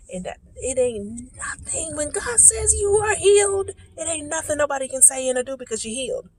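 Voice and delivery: high-pitched, soft preacher's cadence; fervent preaching. Real, not acted. Preaching healing to the viewers.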